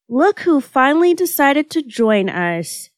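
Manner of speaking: playful, lilting jibe; teasing. A narrator's reading. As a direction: draw it out to tease a friend. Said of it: "teased Kaoru".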